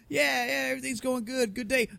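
high, sing-song fake brightness; fake cheerful. Host faking cheer to the nurse, high and sing-song. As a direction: chirp it like everything's fine. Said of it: "I'm like".

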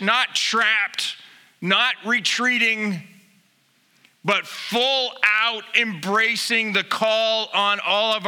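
rally the church with rising fervor; full-force preaching. Acted.

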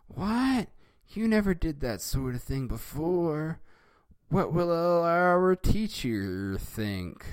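put-on high, astonished kid voice, slowing at the end; surprised. Milhouse stunned by Bart's behavior, high surprised voice. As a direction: gasp it out, stunned by a friend's change. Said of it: "surprised with it".

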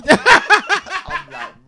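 high, loud laughing. Real, not acted. Telling friends the punchline, high loud laughing. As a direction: laugh through the words.

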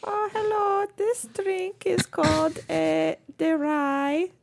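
high, slow creaky grandma voice; old lady voice. Acted. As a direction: play a sweet old Italian granny. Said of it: "Debbie Ryan old lady voice".

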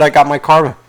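usual speaking voice

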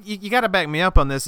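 usual speaking voice

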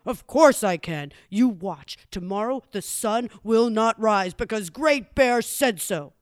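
loud, self-important declaration; cocky boast. Acted. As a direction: announce it as a bragging bear. Bear boasting he can stop sunrise.

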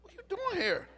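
very high, startled protest; high-pitched mock dismay; young self objecting. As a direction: squeak it like an embarrassed kid. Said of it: "And I looked up like".

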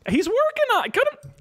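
high, hammed-up squeal; squeaky high bartender. The bartender whining to impatient customers, squeaky high voice. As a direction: protest in a shrill comic squeak.

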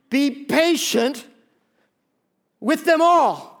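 very slow, loud and high; emphatic. Shown as a demonstration. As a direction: drive the verse home. Emphatic repeat.

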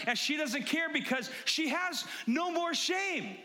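high-pitched, fired-up preaching; carried away, emphatic sermon voice; getting really loud. Real, not acted. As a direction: preach it with rising excitement. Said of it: "I'm getting really loud".

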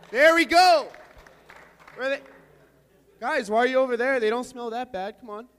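high, loud playful calling; calling from far away. Real, not acted. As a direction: call out to the far seats. Calling across the room to sophomores.

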